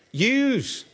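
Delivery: "Yous." in Scouse, high and pointed. Retold or imitated. Preacher putting Paul's command into Scouse, high "Yous.". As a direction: give Paul a Liverpool "Yous.". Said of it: "In Scouse".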